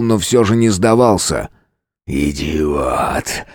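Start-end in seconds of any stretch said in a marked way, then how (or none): 2.1-3.5 deep, much softer beast; monster voice. A narrator's reading. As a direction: spit it low like a monster. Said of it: "произнёс он".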